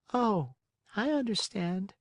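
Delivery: high, gentle snail voice; the snail answering kindly. Acted. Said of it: "said the snail".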